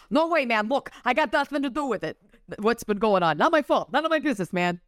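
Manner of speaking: a jittery Long Island con man; Long Island accent. Acted. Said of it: "A little Long Island action there".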